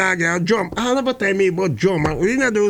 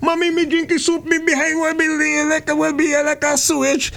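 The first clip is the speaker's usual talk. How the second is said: put-on boy's voice; 7 semitones higher than usual. Boy explaining.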